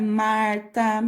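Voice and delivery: "Marta" slowly, stretching the R before "ta"; R puxadinho. Shown as a demonstration. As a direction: draw out the R in "Marta". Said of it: "a gente dá uma puxadinha". ("Marta" devagar, com o R puxado)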